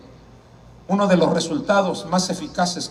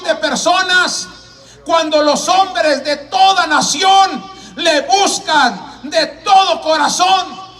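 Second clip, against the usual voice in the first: building, emphatic pulpit proclamation; fervent evangelist's delivery; preaching at full force; 10 semitones higher, 7 dB louder than usual. Acted. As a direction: drive each phrase home, climbing to a high ringing pitch.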